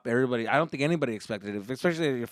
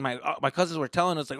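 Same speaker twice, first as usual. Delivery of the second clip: ordinary talk, nothing marked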